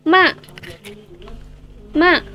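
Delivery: "ma" dropped short and sharp from high; falling fourth tone. Shown as a demonstration. Teacher demonstrates the fourth tone on "ma", short sharp fall. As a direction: stamp "ma" downward. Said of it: "Nada keempat itu".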